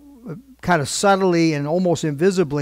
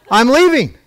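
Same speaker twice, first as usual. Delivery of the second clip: raised, higher-pitched outburst; retold defiant shout; 7 semitones higher, 7 dB louder than usual. Pastor to the man blocking his way, acted defiant shout. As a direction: fire it back loudly, acting out the standoff. Retold shoving match with Baptist.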